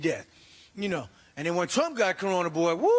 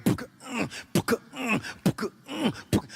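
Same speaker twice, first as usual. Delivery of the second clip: put-on rising grunting chant of a straining toddler; mock baby straining noises, climbing higher; pooping toddler grunts; 4 semitones higher than usual. Toddler pooping while walking.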